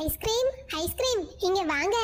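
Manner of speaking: high sing-song hawker call; vendor calling. Acted. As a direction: call out the wares. Ice cream vendor calling out.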